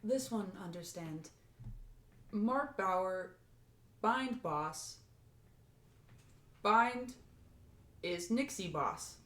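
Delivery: soft, slow robot speech; spaced-out words like a machine. Acted. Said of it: "more robot voice".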